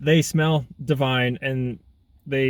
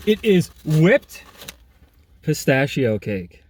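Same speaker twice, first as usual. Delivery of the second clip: slow reveal; 3 dB louder, much slower than usual. Real, not acted.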